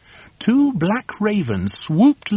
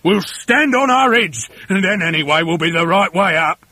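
loud, higher, eager voice; raised-voice husband with a scheme; Mister Twit crying out; 5 semitones higher, 5 dB louder than usual. A narrator's reading. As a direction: announce the plan excitedly. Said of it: "cried Mister Twit".

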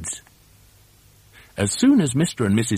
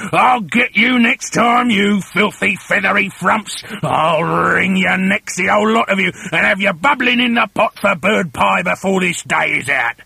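screaming threats; 7 semitones higher, 3 dB louder than usual. Acted. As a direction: rant furiously at the birds, stretching the threats. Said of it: "Mister Twit screamed at the birds".

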